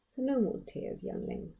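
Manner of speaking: queen hushing softly, last word whispered; soft murmur. A narrator's reading. Queen comforting her crying daughter, soft murmur. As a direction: gently soothe her tears away, fading to a whisper. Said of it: "she murmured".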